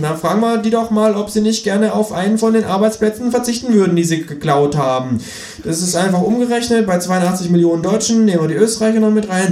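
high, pert kangaroo voice lecturing; sassy kangaroo holding forth; cheeky kangaroo. Acted. Kangaroo mocking the economist on live TV, high sassy voice.

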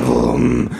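short revving engine imitation; mouthed engine noise; lawnmower roar. A narrator's reading. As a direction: growl out a motor rev.

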